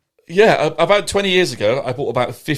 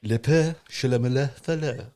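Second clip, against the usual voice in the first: French dialect; 6 dB quieter than usual. Shown as a demonstration. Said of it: "how do the French say it".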